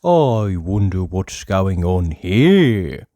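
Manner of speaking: drawn-out curious musing to himself; curious Mister Nosey. A narrator's reading. Mister Nosey wondering at a closed door, slow and curious.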